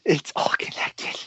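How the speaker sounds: whispered conspiracy theory; hushed mock revelation. Co-host repeating the conspiracy joke to his friend, hushed mock revelation. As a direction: whisper it like a great secret.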